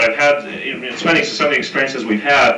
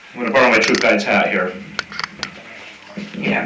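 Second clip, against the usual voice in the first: ordinary talk, nothing marked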